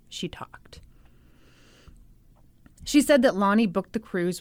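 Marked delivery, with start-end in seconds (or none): 0.1-0.8 hushed, unvoiced whisper. Host telling that Amy finally talked, in a whisper. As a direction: whisper it like a secret.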